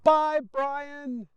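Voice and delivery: high shouted goodbye; boy yelling goodbye to the driver. Acted. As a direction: call farewell across the road. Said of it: "yells little Mikey".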